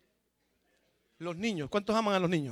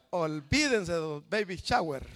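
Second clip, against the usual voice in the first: usual speaking voice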